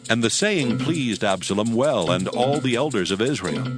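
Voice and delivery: usual speaking voice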